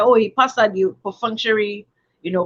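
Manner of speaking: normal talking voice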